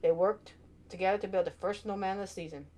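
ordinary delivery

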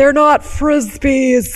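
slow, loud, offended correction; indignant correction. Pretend. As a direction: insist they're not Frisbees. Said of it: "I shouldn't have said it like that".

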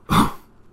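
harsh, dying cough; wet cough. Acted. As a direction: cough up the last air. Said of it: "Coughing out the last of my air".

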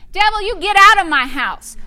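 loud, high, forceful command; feisty authority. Shown as a demonstration. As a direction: order the devil out with authority. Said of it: "You've gotta get a little feisty".

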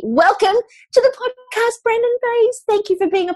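high, bright, eager greeting; excited welcome. Real, not acted. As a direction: welcome her in with delight. Host greets the guest.

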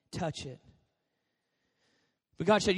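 ordinary talk, nothing marked

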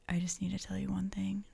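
soft, whispery, cautious lead-in; hushed confession. Retold or imitated. Husband to wife once both are calm, hushed and careful.

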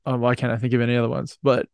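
normal talking voice

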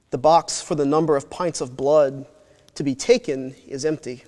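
usual speaking voice

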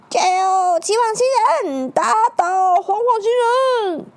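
high, loud crowd cheer; root loudly for the hero. Acted.

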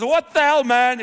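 shocked yell. Retold or imitated. Silas startled when Paul flips his table, loud shocked yell. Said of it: "Silas goes".

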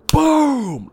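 high, booming thump sound; imitated loud thump. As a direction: imitate a sudden thump with a falling "boom". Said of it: "you hear a loud thump".

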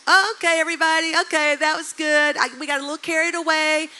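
loud, high announcer call easing down to everyday volume; acted-out calling to a crowd, fading; camp leader. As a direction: imitate a leader breaking up the crowd, big at first then settling. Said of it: "one of the leaders".

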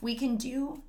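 usual speaking voice

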